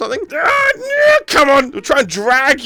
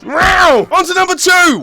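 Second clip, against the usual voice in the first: excited shout; 10 semitones higher, 4 dB louder than usual. Real, not acted. Hits Granny hard.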